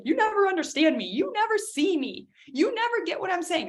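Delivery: high plaintive whine; hurt complaint. Retold or imitated. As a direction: pour out feeling unseen. Upset partner complains.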